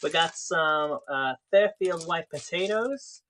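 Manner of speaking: slow, put-on American accent; light mock American accent. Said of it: "Give us an American accent".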